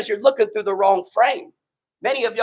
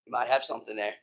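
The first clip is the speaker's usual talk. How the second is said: soft, lower, laughing concession; quiet chuckling give-in; sheepish laughing; 11 semitones lower, 11 dB quieter, faster than usual. Retold or imitated. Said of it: "And I was like".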